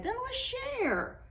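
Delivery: put-on high, soft little girl voice. A narrator's reading. Little girl offers the snowman her hat, high and soft. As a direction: sound like a small kind girl.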